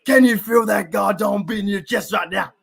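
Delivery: loud, high-pitched hype-up shout; Brian Dawkins. Retold or imitated. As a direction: roar it like a player firing up the team. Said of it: "when he's like".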